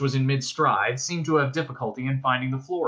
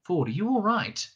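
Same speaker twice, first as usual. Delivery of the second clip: higher, worried query; concerned question; 7 semitones higher than usual. A narrator's reading. Arthur asking stunned Ford, concerned. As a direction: check on a friend, anxiously.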